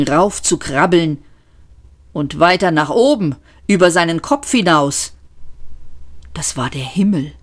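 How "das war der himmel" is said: whispered, awed reveal of the sky; a hushed whisper of dread. A narrator's reading. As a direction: breathe it out in dread.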